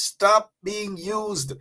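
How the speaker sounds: every word slow and separate, stop leaning hard; slow emphatic command. Shown as a demonstration. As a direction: preach it, one word at a time. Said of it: "Turn to your neighbor and say".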